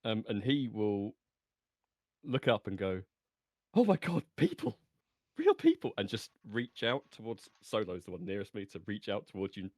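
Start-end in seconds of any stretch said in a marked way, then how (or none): 3.7-5.9 high, loud burst of astonishment; amazed, excited. Acted. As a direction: cry out in wonder at finding company. Character amazed to meet people.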